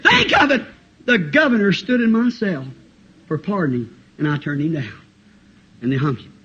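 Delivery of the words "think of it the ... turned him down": acted last words; anguished regret. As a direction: cry out, then sink into defeat. Condemned boy's last regretful words.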